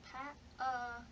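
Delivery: Pa-a said as two open syllables, the n and d dropped; simplified model word. Shown as a demonstration. Therapist models an easy Pa-a for a child learning panda. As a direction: model the easy version Pa-a, pa then a. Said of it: "say it twice".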